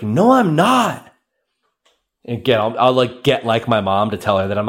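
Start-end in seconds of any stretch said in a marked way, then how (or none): 0.0-1.1 indignant denial. Retold or imitated. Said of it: "I'm like".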